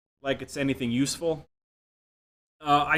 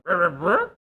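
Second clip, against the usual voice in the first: nonsense syllables with a higher questioning lilt; mumbled rising gibberish; 5 semitones higher, 6 dB louder, slower than usual. Retold or imitated. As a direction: imitate talk heard as noise, rising. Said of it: "a little up intonation".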